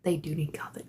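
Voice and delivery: whispered aside. Real, not acted. Vlogger muttering aside about Walmart, whispered. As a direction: drop to a whisper.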